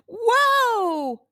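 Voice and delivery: high, loud call to stop a horse; girl shouting whoa at a bolting horse; shouted whoa. Acted. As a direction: yell it to halt a runaway horse. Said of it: "she shouted".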